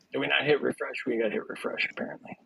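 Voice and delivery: whispering to herself; whispered aside. Real, not acted. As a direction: drop to a whisper. Aside while fixing the screen.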